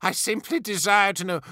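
nervous, cowed plea; frightened pleading. A narrator's reading. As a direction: stammer out an excuse to an angry lord. Officer pleads while being choked.

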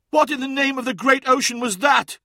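high, loud outcry of shock; alarmed cry. A narrator's reading. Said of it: "cried Sobek".

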